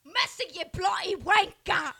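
slow, loud mock British accent. Showing off her British accent for friends. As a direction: ham up a British accent, drawn out. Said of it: "I just love your British one".

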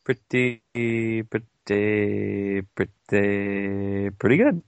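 a loose Larry David "pretty, pretty good"; Larry David impression. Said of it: "that was a terrible Larry David impression".

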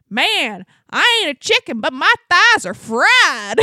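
loud, high-pitched Texan complaint; drawn-out, hollered Texas twang; thick Texas accent. Retold or imitated. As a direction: imitate a big Texan toiling uphill. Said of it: "he has the thickest Texas accent".

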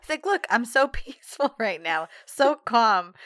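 hammed-up fake serenity; mock calm. Imitating John claiming calm, mock insistence.

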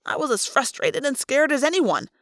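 ordinary delivery, nothing marked

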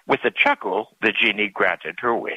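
ordinary delivery

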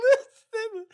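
squeaky laugh breaking through the word; can't get the word out for laughing. Real, not acted. To his cohost, laughing too hard to say listen. Laughing while starting the story.

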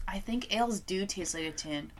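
whispered aside. Real, not acted. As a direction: whisper it like a confession. Aside after tasting beer.

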